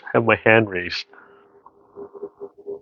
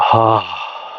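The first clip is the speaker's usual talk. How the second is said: demo sigh. Shown as a demonstration. Teacher showing the class a yawning ha exhale. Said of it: "that yawn kind of mechanism and that ha sound".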